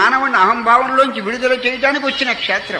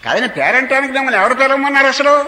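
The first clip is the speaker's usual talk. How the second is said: raised, fast indignant challenge; indignant retort; 4 semitones higher than usual. Acted. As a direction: fire back who invited you at all. Preacher voices indignant guest.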